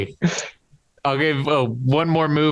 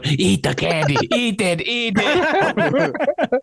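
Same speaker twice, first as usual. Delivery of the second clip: slow, much higher creepy coax; creepy coaxing demon; 9 semitones higher, slower than usual. Retold or imitated. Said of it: "Doug, just going".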